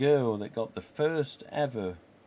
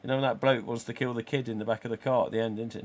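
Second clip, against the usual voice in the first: ordinary talk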